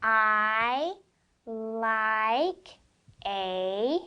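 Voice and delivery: reading each word slowly with a pause between; slow pointed reading for a child. Shown as a demonstration. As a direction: read it slow, one word at a time. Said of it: "Let's read it kinda slow".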